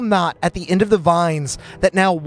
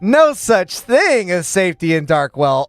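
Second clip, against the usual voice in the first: taunting; slower than usual. Acted. GM taunting players about danger.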